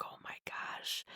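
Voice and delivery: breathless scared whisper. Retold or imitated. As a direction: whisper it to yourself in shock. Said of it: "And I'm just like".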